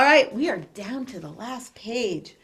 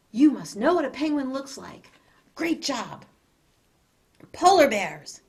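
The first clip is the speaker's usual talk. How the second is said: plain reading voice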